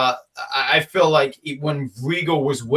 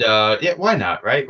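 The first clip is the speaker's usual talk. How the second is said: ordinary delivery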